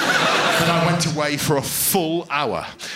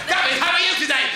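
higher-pitched, hurried retry; exasperated teacher; 11 semitones higher, faster than usual. Acted. Teacher trying to trick a pupil, exasperated. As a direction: ask it fast and high, losing patience.

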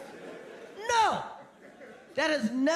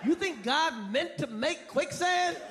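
ordinary talk, nothing marked

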